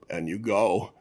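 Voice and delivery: low, soft deadpan action-hero line; mock tough one-liner. Retelling the movie's final line, low deadpan hero quip. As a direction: deliver it like a cool movie kill line. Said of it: "Lundgren goes".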